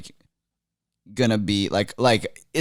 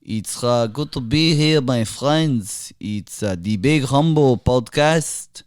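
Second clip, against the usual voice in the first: put-on Middle Eastern accent; 2 semitones higher than usual. Guest doing a requested Middle Eastern accent for the host. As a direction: play a pompous Middle Eastern dignitary.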